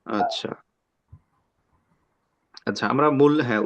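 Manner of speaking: usual speaking voice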